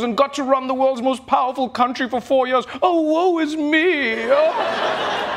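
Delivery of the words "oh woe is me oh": high, slow, hammed-up lament; mock woe. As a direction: wail it like a pampered martyr. Mocking billionaire's self-pity.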